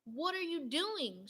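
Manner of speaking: loud startled cry, slowed; shouted. A narrator's reading. Donut Arnie shouting at Mister Bing about to eat him, alarmed. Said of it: "shouted Arnie".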